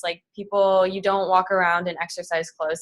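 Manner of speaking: ordinary delivery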